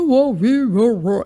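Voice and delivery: a playful yowl of whoa, wee; imitated dog whoa sounds. Owner copies her dog's noises, playful imitated yowls. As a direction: mimic a big dog talking back.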